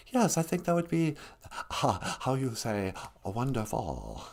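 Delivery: soft, slow mock Chinese accent for the puppet; lightly put-on Chinese accent, quiet. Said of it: "he's got a certain stereotypical accent".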